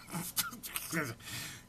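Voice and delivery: breathless stifled giggle, fighting it; a stifled laugh mid-sentence; suppressed giggle. Real, not acted. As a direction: try not to crack up and fail. Breaks up reading bloopers.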